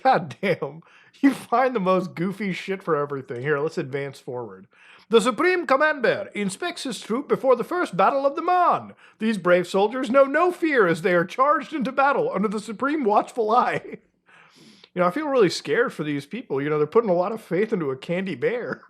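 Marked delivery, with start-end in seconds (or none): 5.1-14.0 high, grand announcer read; mock-heroic narration. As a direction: hail the bear like a war hero. Reads bear legend in announcer style.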